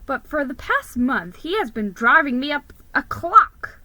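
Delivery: ordinary delivery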